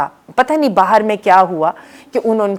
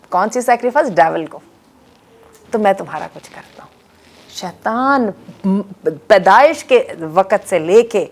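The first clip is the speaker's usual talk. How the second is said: ordinary talk, nothing marked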